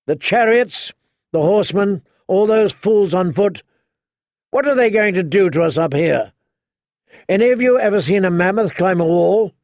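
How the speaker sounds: high, loud mocking challenge; rallying shout. A narrator's reading. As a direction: rouse the troops with scorn. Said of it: "He was shouting now".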